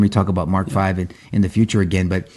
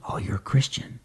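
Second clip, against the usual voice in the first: breathy, secretive whisper; 3 semitones lower, 10 dB quieter than usual. Retold or imitated. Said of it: "she leaned in and goes".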